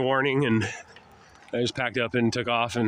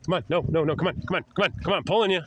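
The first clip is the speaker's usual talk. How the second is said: higher, repeated calls to a pet; urging the dog; 5 semitones higher, faster than usual. Real, not acted. Owner pulling the dog along on the walk, urging. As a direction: hurry a straying dog along with quick repeats.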